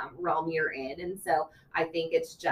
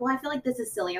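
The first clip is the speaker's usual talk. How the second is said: imitating a sheepish parent; 7 semitones higher, faster than usual. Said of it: "they're like".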